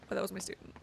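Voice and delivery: ordinary talk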